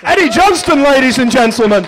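hyped-up stage introduction; announcer voice. Acted. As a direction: call it like a compere. Announcing the performer.

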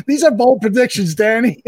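chuckling while teasing his own pick; laughing. Real, not acted. Laughing as he teases Danny about his bold pick.

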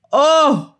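ordinary delivery

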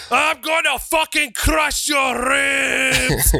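high, slow roaring threat; heel shout. Acted. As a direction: roar it out for the crowd. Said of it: "loud enough for the crowd to hear".

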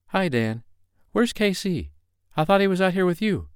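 high-pitched woman asking after someone; friendly young woman's voice. Acted. Woman greets the uncle and asks where her lover is, high female voice. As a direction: pitch it well up as the woman of the story.

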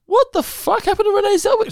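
very high shocked squeal; mock shocked crowd. Everyone reacting to Renee Zellweger's face, high squeal. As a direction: squeal it in disbelief. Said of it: "Everyone was like".

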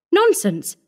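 high-pitched, firm scoff; firm dismissal. A narrator's reading. Visitor brushing off the matron's warning, firm dismissal. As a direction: dismiss it firmly on a jump in pitch. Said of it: "squared her shoulders firmly".

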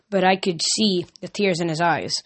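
ordinary delivery, nothing marked

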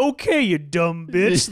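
loud, drawn-out put-down; hammed-up, slow and loud dismissal; mock condescending. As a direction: talk down with exaggerated contempt. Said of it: "even more fucking condescending".

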